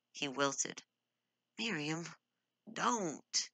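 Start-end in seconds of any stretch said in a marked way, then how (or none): 1.6-3.5 groaning. Acted. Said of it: "he groaned".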